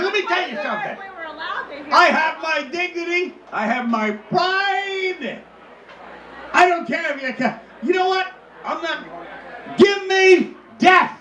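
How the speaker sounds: hammed-up noble stand, building to a shout; mock defiant speech.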